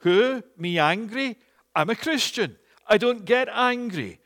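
a hint of a defensive churchgoer; indignant denial. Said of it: "you're thinking as I'm saying this".

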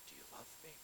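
the Lord gently questioning Peter on the shore; tender question. Retold or imitated. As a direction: ask it softly, full of love. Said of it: "the Lord says to him".

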